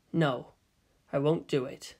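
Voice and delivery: low, soft refusal; quiet refusal. Acted. Shinji refusing to pilot, low and quiet.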